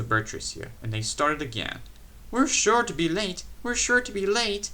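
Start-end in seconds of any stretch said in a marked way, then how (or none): 2.3-4.7 high, frantic panted repeat; anxious little engine, higher; frantic panting. A narrator's reading. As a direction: gasp it out in a rush to be on time. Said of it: "panted Peter Sam frantically".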